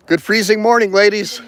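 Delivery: loud high call to passers-by; cheerful greeting. Real, not acted. Vlogger greets women jogging past, loud cheerful call. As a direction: call out a bright good morning.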